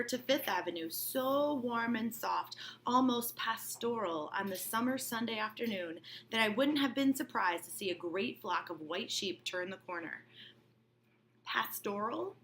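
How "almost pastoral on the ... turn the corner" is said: ordinary talk, nothing marked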